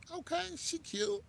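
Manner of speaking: high-pitched squeak of approval; approving aside. Acted. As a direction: pitch it way up, like a pleased thought. Acting out the dater's approving thought.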